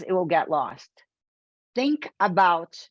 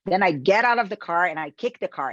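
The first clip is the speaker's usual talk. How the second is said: usual speaking voice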